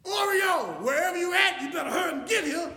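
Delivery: loud, high, impatient holler; mad shout. Retold or imitated. Owner searching late for the lost dog, angry shout. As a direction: yell for the dog, fed up. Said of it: "I was getting mad".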